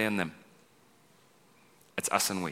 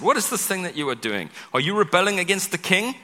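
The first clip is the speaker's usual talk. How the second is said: jeering; 8 semitones higher, 3 dB louder than usual. Acted. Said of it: "they jeered at us".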